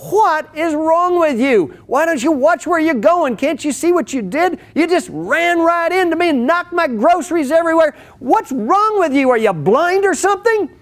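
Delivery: high, loud indignant scolding; furious wife yelling at a stranger who bumped her; angry wife. Acted. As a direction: rant at him for knocking the groceries over. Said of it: "anger fills her heart".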